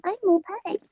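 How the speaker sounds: high, soft sugary mimicry; mock-sweet little high voice; fake perfect. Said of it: "those people who are like".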